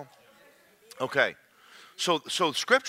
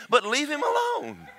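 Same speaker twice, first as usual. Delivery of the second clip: usual speaking voice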